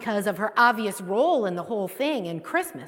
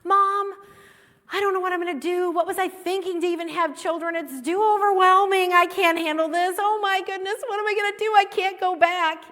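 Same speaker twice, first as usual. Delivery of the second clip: high, loud, panicked pleading; a frantic daughter on the phone to her mom; overwhelmed and close to panic; distraught; 7 semitones higher, 5 dB louder, faster than usual. Retold or imitated. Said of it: "I was just so distraught".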